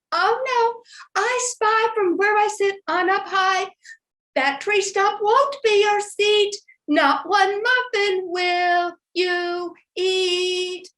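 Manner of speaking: put-on high, ringing little-girl voice; watchful Masha. A narrator's reading. Masha spying on the bear from up high, high singsong warning. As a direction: call it out high, slowing into singsong.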